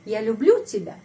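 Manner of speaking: люблю punched up, falling after it; stress on люблю. Shown as a demonstration. Teacher demonstrates question intonation, stress on люблю. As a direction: ask it by lifting the pitch on люблю. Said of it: "penekanan intonasi pada kata kerja люблю".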